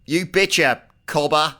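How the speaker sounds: loud, high, hammed-up Australian accent. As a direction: lay the Aussie slang on thick. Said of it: "Cobber, wow".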